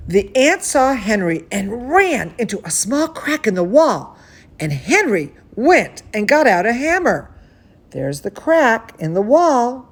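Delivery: put-on bright, lively read-aloud for kids; storybook narration to children; story reading. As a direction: read it like bedtime story time. Reading a picture book aloud.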